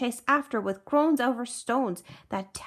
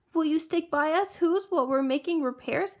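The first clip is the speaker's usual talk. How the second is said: higher pleading request; 6 semitones higher than usual. A narrator's reading. Tiny mayor begs Horton to stay, pleading. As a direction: ask pleadingly in a small raised voice. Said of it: "pleaded the voice of the mayor".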